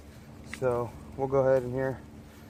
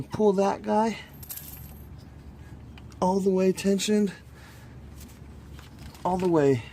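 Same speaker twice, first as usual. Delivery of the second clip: straining effort; 6 semitones higher than usual. Real, not acted. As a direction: strain against the belt tension. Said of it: "I'm trying to hold this".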